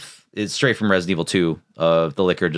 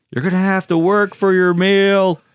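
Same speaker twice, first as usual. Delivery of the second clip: loud, high, drawn-out movie quote; movie-line impression; 12 semitones higher, 5 dB louder, slower than usual. Host quoting the iconic zombie-movie line, loud hammed-up shout. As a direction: belt the line out slowly, hammed-up.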